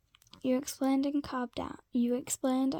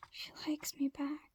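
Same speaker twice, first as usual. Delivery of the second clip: whisper; 2 semitones higher, 7 dB quieter than usual. A narrator's reading. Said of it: "you whispered again a little louder".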